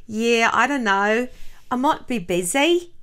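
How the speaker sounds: put-on Australian accent slipping into British; slow, drawn-out attempt at an Australian accent; botched Australian accent that comes out British. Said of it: "That's British. I have a really good Australian accent".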